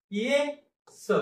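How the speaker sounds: very slow, syllables pulled apart; split apart. Shown as a demonstration. Teacher showing pupils the wrong way: ये, स slowly split. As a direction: drag ये and स apart with a long gap. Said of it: "असं वाचायचं नाही".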